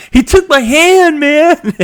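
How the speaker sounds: very high, loud drawn-out movie wail; hammed-up wailing. Host quoting the Blade sidekick, high hammed-up wail. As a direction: wail it like a mock-outraged sidekick.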